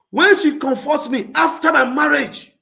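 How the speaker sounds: shouted high and loud; outraged shout about her; loud rant. Real, not acted. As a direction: yell it out indignantly. Ranting loudly about ex.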